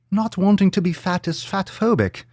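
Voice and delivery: high, mocking English-Irish lilt; muddled English-Irish accent. Podcaster mockingly rereading a tweet, high mock English-Irish accent. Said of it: "I don't know what accent this is".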